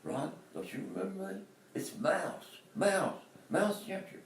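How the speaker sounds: put-on high, insistent old friend's voice; imitating a friend begging to be remembered; pleading fraternity brother. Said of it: "he kept saying".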